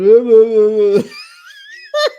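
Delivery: loud wobbling nonsense wail breaking into laughter; high, babbling mimicry, then cackle; mocking noise. Vlogger showing one woman mocking another, taunting noise. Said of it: "so she's mocking Brynn".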